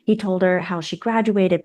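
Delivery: ordinary talk, nothing marked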